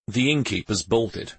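ordinary delivery

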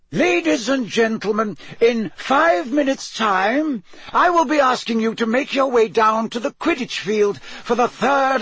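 high, projected headmaster voice; headmaster's announcement. Acted. Headmaster calls the champions to the stadium, formal announcement. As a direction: address the whole hall, pitched well up.

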